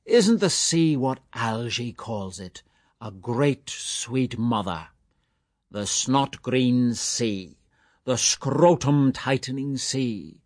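a hint of teasing mockery, slowed; mock outrage, playful; lightly voiced jester. A narrator's reading. Mulligan teasing Stephen about his name, light mock scorn.

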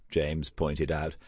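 usual speaking voice

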